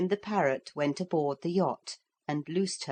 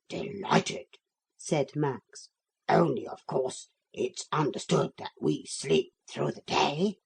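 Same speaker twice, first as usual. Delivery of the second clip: weighty polite agreement; stately old dog; 2 semitones lower than usual. Acted. Max speaks.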